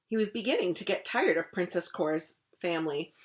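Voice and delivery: usual speaking voice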